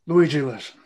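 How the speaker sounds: ordinary talk, nothing marked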